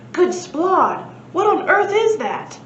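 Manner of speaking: high, loud startled outburst; a professor's amazed cry; astonished exclamation. Acted. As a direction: blurt it out in shock. Professor startled by second balloon.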